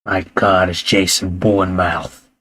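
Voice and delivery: low, soft hushed awe; hushed mock reverence; mock awed hush. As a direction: breathe it like a revelation. Mock awe joking about name.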